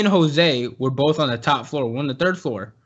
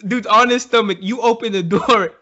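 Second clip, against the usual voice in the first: usual speaking voice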